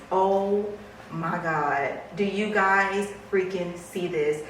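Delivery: usual speaking voice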